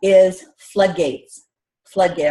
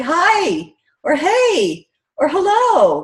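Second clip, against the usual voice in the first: high, bright-eyed hello with a big smile; excited greeting; 7 semitones higher, 3 dB louder, much slower than usual. Shown as a demonstration. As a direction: greet them like you're thrilled to see them. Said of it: "and I'm smiling, and I'm excited".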